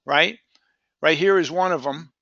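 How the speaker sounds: ordinary delivery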